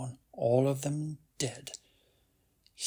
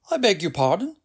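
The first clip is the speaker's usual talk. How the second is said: higher, louder, affronted stranger; offended passer-by; 6 semitones higher, 8 dB louder, faster than usual. A narrator's reading. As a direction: pull up short, taken aback. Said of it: "said a passer-by".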